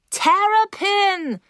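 high, drawn-out cry of a name; high-pitched narrated shriek; screeched call. Acted. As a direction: screech it out, stretching the word. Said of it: "kreischte ich".

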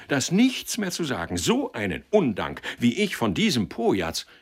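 worked-up, emphatic accusation; indignant, wounded old acquaintance. Acted. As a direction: vent bitter outrage at ingratitude.

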